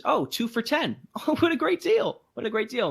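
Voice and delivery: high, delighted exclamation, retold; excited bargain delight. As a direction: act out being thrilled by a deal.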